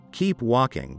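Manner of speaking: ordinary delivery, nothing marked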